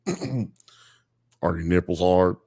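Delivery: low, very slow seductive line; mock sultry man. Mocking the man on the tape, low slow sultry voice. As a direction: drag it out like a cheesy seducer. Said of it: "he'd be like".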